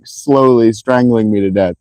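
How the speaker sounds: ordinary delivery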